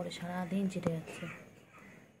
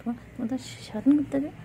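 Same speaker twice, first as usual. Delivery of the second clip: excited; 7 semitones higher, 6 dB louder, faster than usual. Real, not acted. Scolding pet playfully.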